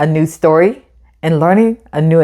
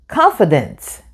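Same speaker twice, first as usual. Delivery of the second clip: usual speaking voice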